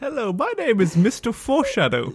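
high, hammed-up English character voice; mock English gentleman. Friend mocks the Englishman as Mister Foreshadow, high English voice. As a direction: parody a pompous Englishman introducing himself.

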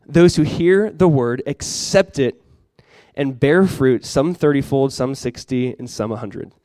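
measured reading of a Bible passage, fading at the end; scripture reading. Acted. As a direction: read the verses slow and solemn. Reads scripture aloud before prayer.

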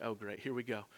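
mock exasperated aside; resigned groan. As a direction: sigh it out, defeated. Resigned customer reaction.

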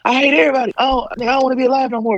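put-on high, whining child voice; whiny kid. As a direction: wail like a sulking kid after a whooping. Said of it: "you like".

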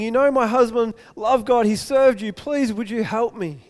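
desperate, high-pitched begging; crying out. Acted. Widow begging the prophet to save her sons, crying out. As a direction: plead urgently, stretching out the key words. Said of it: "she cries out and says".

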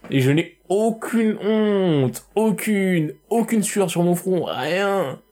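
slow, high, grandly shameless; mock proud declaration. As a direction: proclaim it with hammed-up pride. (déclaration faussement solennelle)

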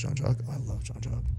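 starstruck fans. Retold or imitated. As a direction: breathe the name in awe. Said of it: "everybody stops and goes".

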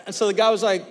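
ordinary talk, nothing marked

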